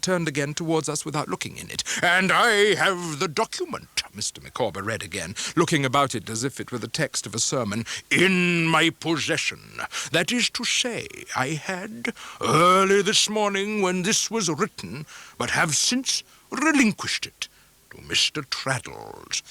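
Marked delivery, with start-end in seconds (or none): 2.0-4.0 lightly voiced Micawber, slow and grand; pompous letter reading. A narrator's reading. 8.1-19.4 lightly voiced Micawber, slow and grand; pompous letter reading. A narrator's reading.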